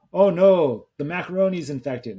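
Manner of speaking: mock alarm from a sketch character, loud high start; lightly imitated comic cry of dismay; Pierre Escargot. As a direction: exclaim it like a silly French sketch character. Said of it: "Pierre Escargot as Kenan".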